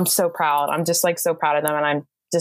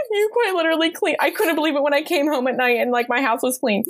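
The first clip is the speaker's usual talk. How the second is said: high, amazed voice of a bride; 9 semitones higher, faster than usual. Retold or imitated. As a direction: play the stunned, grateful bride. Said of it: "She's like".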